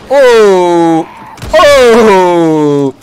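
long, loud falling ooh; booming ooh that slides down; loud "oo" exclamation. Real, not acted. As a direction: belt out a drawn-out ooh twice. Zombie comes back, he exclaims.

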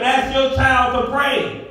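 usual speaking voice

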